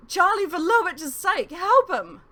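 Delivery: very loud, high scream of the name. Acted. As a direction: scream his name in horror. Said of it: "Talgith was screaming now".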